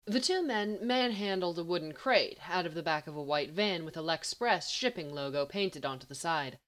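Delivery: usual speaking voice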